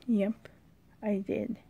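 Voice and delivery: usual speaking voice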